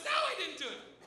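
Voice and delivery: high mock protest; imitated accused girl. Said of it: "She goes".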